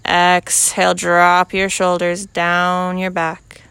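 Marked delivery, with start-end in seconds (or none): none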